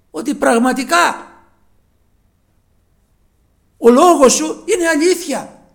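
loud, high pleading sermon voice; preaching at full force. Acted. Preacher urging listeners to pray to see the Lord, fervent full-force preaching. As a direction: urge them to ask God, rising to a plea.

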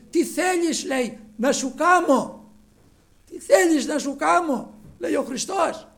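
loud, high, rousing sermon voice; preacher calling out at full voice; full-force preaching. Acted. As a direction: proclaim the good news to the whole congregation. Preaches Christ's question at full force.

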